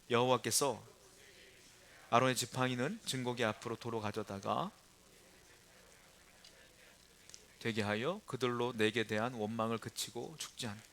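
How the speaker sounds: soft, measured verse reading; scripture reading. Acted. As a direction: read the passage quietly, line by line. Reads scripture aloud. (조용히 성경 봉독)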